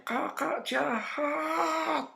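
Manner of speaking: high, drawn-out wail, kept soft; imitated wailing cry. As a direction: mimic a girl crying out in accusation. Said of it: "Terus dia teriak". (tiruan teriakan merengek, melengking tapi pelan)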